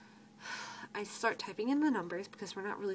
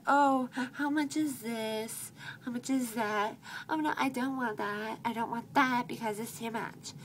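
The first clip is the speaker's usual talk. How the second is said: higher, loud whiny-customer imitation; mocking customers; 4 semitones higher, 5 dB louder than usual. Customers fussing to the cashier about prices, mockingly imitated. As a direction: draw out the first question, mock the fussing.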